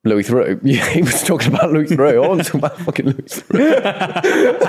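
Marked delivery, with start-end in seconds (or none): 2.2-4.7 high laughing protest. Real, not acted. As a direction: protest while cracking up. Mock outrage, laughing.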